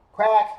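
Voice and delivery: sudden loud, high quack; duck quack. Acted. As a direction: burst in with a sharp quack. Duck joins the chorus.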